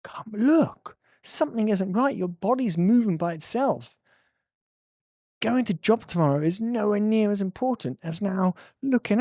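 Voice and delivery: high, insistent urging; urgent wake-up plea. Acted. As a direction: plead with them to wake up. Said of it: "shake people up and go".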